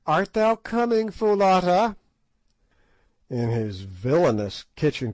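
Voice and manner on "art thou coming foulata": higher, brisker gentleman's voice; Good challenging Gagool and Infadoos; Good's voice. A narrator's reading. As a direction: speak up as a bold English officer.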